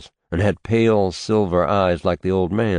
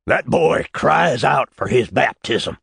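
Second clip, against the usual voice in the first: raised, louder preaching voice; fervent old preacher; 7 semitones higher, 4 dB louder than usual. Acted. Old man insisting the child must be baptized, preaching loud. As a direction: declare it like scripture.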